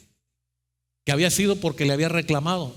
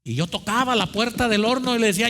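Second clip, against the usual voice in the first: usual speaking voice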